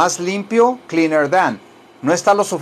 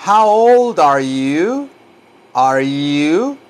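exaggerated slow model; much slower than usual. Shown as a demonstration. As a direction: draw each vowel out for imitation. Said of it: "Imiten mi voz".

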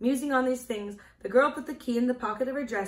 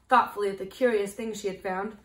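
plain reading voice

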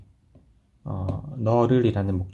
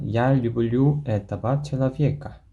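slow model sentence; 3 semitones higher, much slower than usual. Shown as a demonstration. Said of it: "해볼까요".

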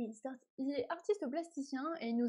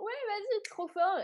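high, loud mock amazement; cheering someone on, impressed; impressed fan; 8 semitones higher, 4 dB louder than usual. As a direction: gush like a wowed viewer. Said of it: "Tu vois, c'est genre".

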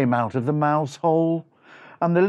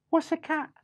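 high, small, innocent squeak; puzzled little mouse voice; Freddy Mouse; 11 semitones higher, 4 dB quieter than usual. Acted. Freddy asking his two brothers, innocently puzzled. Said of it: "and Freddy Mouse said".

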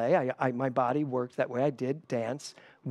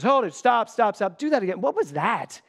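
director yelling; 8 semitones higher, 7 dB louder than usual. Retold or imitated. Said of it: "He goes".

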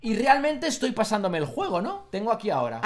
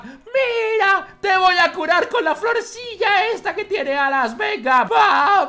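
excited; 7 semitones higher, 4 dB louder, slower than usual. Real, not acted. Excited healing of patient.

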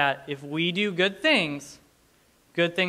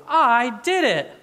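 put-on loud, high, drawn-out toddler cheer; excited toddler; 7 semitones higher, 7 dB louder, slower than usual. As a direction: imitate a small child's proud announcement. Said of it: "she is so excited about doing".

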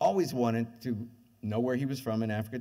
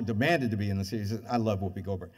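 ordinary talk

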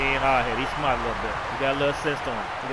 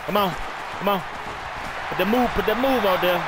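higher, louder urging; excited cheer; 6 semitones higher, 3 dB louder than usual. Real, not acted. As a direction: push the runner on, hyped. Cheering runner on.

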